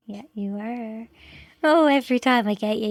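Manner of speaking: ordinary talk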